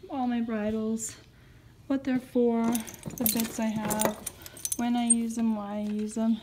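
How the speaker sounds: ordinary talk, nothing marked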